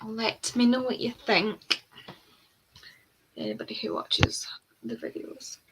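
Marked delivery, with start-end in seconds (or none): 3.4-5.6 whispered aside. Real, not acted.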